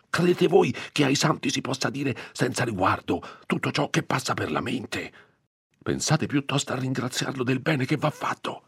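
high, strained scolding; agitated reproof. A narrator's reading. Priest reproving Agnese for careless talk, agitated. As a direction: lecture her with urgent indignation.

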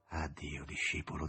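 soft, low farewell; barely audible. A narrator's reading. The master bidding his disciple goodbye, barely audible. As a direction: let it almost vanish. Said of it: "con voce appena percettibile".

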